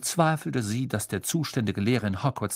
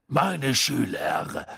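lowered larynx voice; much slower than usual. A narrator's reading. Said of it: "lässig".

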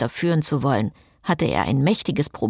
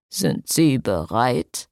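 lower, slow, gently smiling question; mild smile; 5 semitones lower, slower than usual. Acted. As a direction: ask the couple kindly, with a smile. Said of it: "fragte er mit einem milden Lächeln". (mild lächelnd, tiefer und langsam)

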